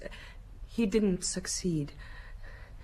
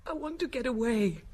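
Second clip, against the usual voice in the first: ordinary talk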